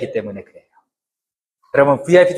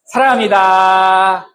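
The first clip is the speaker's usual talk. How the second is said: slow, drawn-out 사랑합니다; slow loving greeting; 4 semitones higher, 3 dB louder, much slower than usual. Shown as a demonstration. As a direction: linger on each syllable tenderly. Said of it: "시작". (천천히 다정하게 "사랑합니다")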